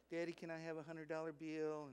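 soft, small pleading daughter's voice; soft, sweet voice of a daughter begging her dad; sweet little girl voice. Retold or imitated. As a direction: coax like a little girl asking Daddy. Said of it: "she got this sweet little voice".

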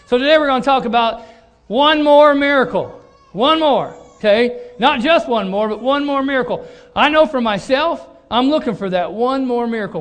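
loud, high preaching voice, building; full-force preaching. Acted. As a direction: preach it out to the congregation.

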